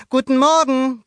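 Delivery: put-on high, loud boyish hello; boy greeting. A narrator's reading. Luca waves to neighbors, high greeting. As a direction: call out the greeting as young Luca.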